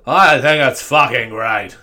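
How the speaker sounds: loud, drawn-out rock-star voice; hammed-up singer's talking voice. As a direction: answer slowly and loudly as a mock punk singer. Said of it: "if he talked like that twenty-four seven".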